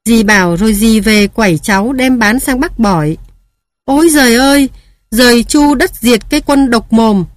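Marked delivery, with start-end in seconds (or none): 3.8-7.2 lament. Acted. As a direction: cry out to heaven in grief. Grandmother lamenting aloud.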